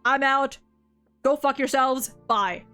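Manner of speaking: usual speaking voice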